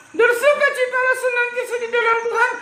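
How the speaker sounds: high, laughing puppet repeating. Acted. Puppet repeats the verse after the teacher, high. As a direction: chirp the verse back as the puppet.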